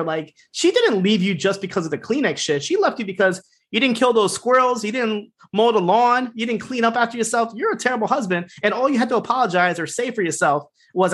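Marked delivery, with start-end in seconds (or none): 0.5-10.1 heated rant. Real, not acted. Ranting about a bad husband.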